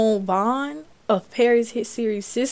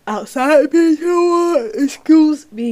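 words stretched through a loud yawn; talking mid-yawn; 6 semitones higher, 10 dB louder than usual. Real, not acted. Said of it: "Excuse me, I am yawning".

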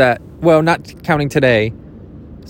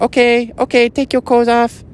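much higher, put-on Japanese-Mexican masseuse voice; mock Japanese-Mexican accent; 10 semitones higher than usual. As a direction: imitate a masseuse in a high, muddled Japanese accent. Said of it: "Yeah, that's better".

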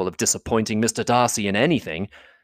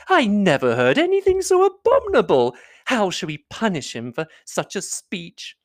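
higher, theatrical indignation; affected outrage; 9 semitones higher than usual. A narrator's reading. Miss Bingley to Elizabeth, feigning scandal at Darcy, affected outrage. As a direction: play the scandalised lady. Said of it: "cried Miss Bingley".

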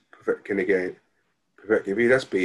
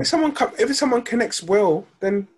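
emphatic high; 13 semitones higher than usual. Real, not acted. Excited point in debate.

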